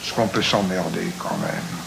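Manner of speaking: a wounded soldier humming low in the cold; cavernous voice. A narrator's reading. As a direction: murmur it from deep in the chest, half-humming. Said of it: "d'une voix caverneuse".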